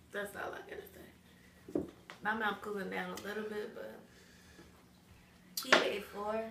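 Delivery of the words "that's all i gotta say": whisper. Real, not acted. Quiet aside after spicy challenge.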